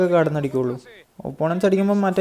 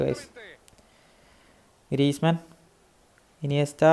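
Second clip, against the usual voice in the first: ordinary delivery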